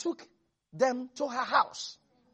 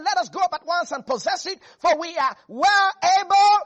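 much higher and louder, triumphant; bold faith proclamation; 7 semitones higher, 9 dB louder than usual. Acted. Caleb urging the people to take the land, loud triumphant. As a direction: thunder it out with full conviction. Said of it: "That is faith talking".

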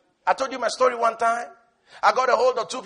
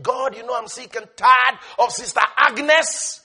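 exasperated complainer; 7 dB louder than usual. Retold or imitated. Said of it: "He's not busy saying".

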